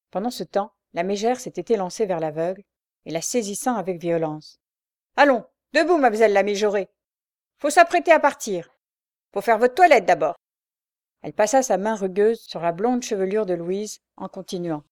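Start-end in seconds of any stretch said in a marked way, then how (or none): 5.2-10.3 high, loud scornful orders; mocking, bullying commands; harsh bossing. A narrator's reading. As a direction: order her up roughly. Shrew roughly orders blind girl up.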